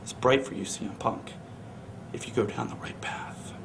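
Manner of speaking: very soft, low whisper; sinister whisper. Acted. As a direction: whisper it like a dark warning. Sinister advice to Punk.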